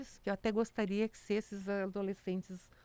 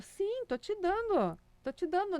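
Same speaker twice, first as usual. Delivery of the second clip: put-on old man teasing; 10 semitones higher than usual. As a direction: tease the child sweetly in a high voice. Old man voiced, teasing reply.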